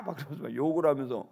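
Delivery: plain reading voice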